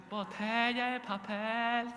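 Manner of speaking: high, loud, long-drawn vendor's call; recyclers' street cry. Retold or imitated. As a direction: imitate a recycler hollering for bottles and paper. Imitating street recyclers' calling cry. (pregón de reciclador, fuerte y alargado)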